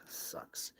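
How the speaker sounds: a breathy, fed-up whisper; whispered disgust. Real, not acted. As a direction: let the word die out in disgust. Fed up with setup.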